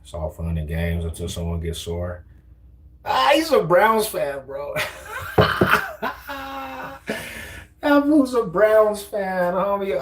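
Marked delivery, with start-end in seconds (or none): 3.0-10.0 high, slow and laughing; high squeaky ridicule through laughter; high mocking laugh-talk. Real, not acted. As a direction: cackle at a Browns fan. Ridiculing a Browns fan.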